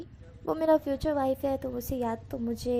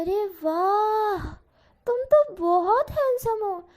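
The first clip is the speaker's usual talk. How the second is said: gushing aunt; 8 semitones higher, 4 dB louder, much slower than usual. A narrator's reading. Aunt gushes over handsome nephew.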